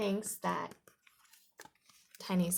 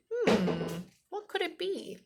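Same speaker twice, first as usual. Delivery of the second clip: pondering; 8 semitones higher, 3 dB louder, slower than usual. A narrator's reading. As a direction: puzzle it over aloud. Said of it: "He scratches his chin and thinks".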